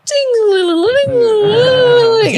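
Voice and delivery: high, ringing ding-a-ling, drawn out; wind chime imitation. Retelling a song's intro, imitated wind chime. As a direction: sing out a shimmering high chime. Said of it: "this crazy, like, wind chime".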